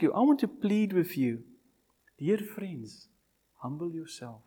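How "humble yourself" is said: soft, pleading humble yourself; hushed appeal. Acted. Preacher softly urging the listeners, hushed. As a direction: say it almost under the breath.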